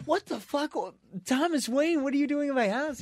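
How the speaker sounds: shriek-pitched mock alarm at an intruder; startled shock. Barry finds an intruder at home.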